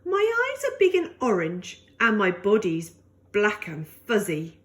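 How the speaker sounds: plain reading voice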